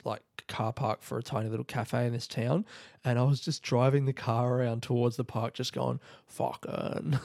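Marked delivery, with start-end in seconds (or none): 6.3-7.2 half-whispered Aussie swear muttered while parking; Australian bloke swearing. Pretend. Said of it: "doing a voice of an Australian man swearing".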